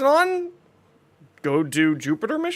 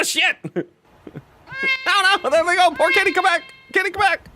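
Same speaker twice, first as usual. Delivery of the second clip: mock panic; 12 semitones higher than usual. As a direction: play up horror as the kitties flee. Cats run away, mock panic.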